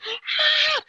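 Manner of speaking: high, sharp panicked gasp; gasping hyperventilation. Retold or imitated. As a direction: gasp for air in mock panic. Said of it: "I am literally like".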